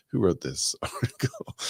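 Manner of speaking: laughing through the question; chuckling in disbelief. Real, not acted. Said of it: "I'm laughing because the".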